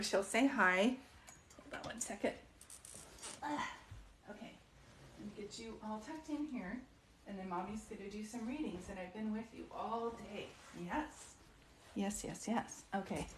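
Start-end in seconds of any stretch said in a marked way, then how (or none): 1.6-4.5 whispered aside off mic; hushed hold on. Real, not acted. Settling her dog off mic. 5.2-13.3 much softer, hushed words to a pet; quiet pet talk away from the mic; soothing the dog. Real, not acted. Tucking her dog in quietly.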